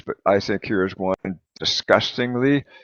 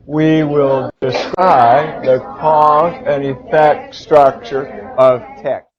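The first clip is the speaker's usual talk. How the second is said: even slower, loud, word by word; slower choral read; 4 semitones higher, 8 dB louder, much slower than usual. Shown as a demonstration. Teacher reading together with students in chorus. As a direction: read it with the class, very slowly. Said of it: "Let's read it".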